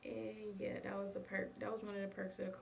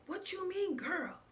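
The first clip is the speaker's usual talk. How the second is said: higher, louder indignant mom; mom flipping out; 11 semitones higher, 7 dB louder, slower than usual. Retold or imitated. Mom yelling at the dealer from the passenger seat, indignant. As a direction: snap back like an outraged mom. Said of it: "my mom flipped from the passenger seat".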